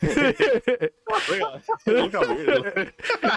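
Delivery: high, slow, laughing through words. Real, not acted. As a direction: squeeze words out between giggles. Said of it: "I'm laughing so freaking hard".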